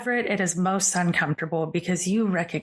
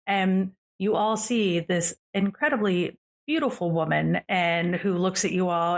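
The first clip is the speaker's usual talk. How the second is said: usual speaking voice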